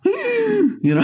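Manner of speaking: high mock gasp of shock; imitated gasp. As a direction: gasp like she's scandalized. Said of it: "she does the gasp".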